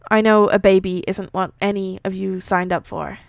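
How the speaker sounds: projected, measured read-aloud; story narration. A narrator's reading. Reading a fan fiction aloud, measured narration. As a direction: read the story out clearly, voicing dialogue lightly.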